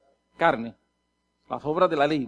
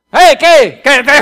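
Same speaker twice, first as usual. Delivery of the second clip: barking like a dog at people; loud, high, aggressive shout; angry yelling; 4 semitones higher, 14 dB louder, much slower than usual. Acted. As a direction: yell at everyone in short bursts. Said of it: "Yo le gritaba a todo el mundo".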